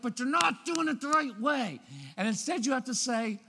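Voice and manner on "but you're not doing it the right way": high, protesting whine; exasperated parent. Acted. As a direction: object like a fussy parent, pitch pushed up. Exasperated parent in acted quote.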